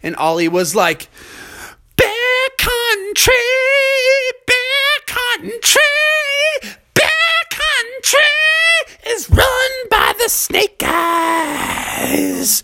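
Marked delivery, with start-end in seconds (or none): 2.0-12.6 very high, slow, drawn-out hype call; stage announcer hype. Acted. Ollie hypes the crowd for the band, high drawn shout. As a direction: stretch each word like a rock show intro.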